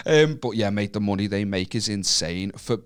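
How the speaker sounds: normal talking voice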